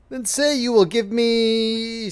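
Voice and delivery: high, slow, sly bargaining; lightly voiced little man. Dwarf bargains with the miller's daughter, slow and sly. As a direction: draw the offer out slyly.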